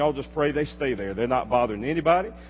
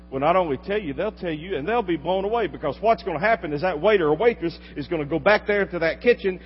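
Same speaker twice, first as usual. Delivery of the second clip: ordinary talk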